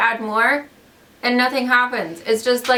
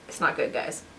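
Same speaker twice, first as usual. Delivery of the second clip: whispered aside; 8 semitones lower, 10 dB quieter than usual. Real, not acted. Aside about bad paint.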